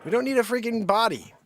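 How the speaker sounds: high-pitched pleading objection; mock protest. Host protesting as Maggie swallows Max's head, mock alarm. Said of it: "and I'm like".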